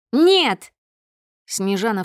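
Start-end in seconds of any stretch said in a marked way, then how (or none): 0.1-0.7 high, loud shout of protest; furious refusal. A narrator's reading. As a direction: block him with an outraged yell. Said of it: "её руки дрожат от ярости". (яростный громкий выкрик)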